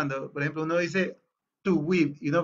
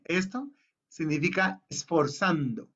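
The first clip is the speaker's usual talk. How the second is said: ordinary talk, nothing marked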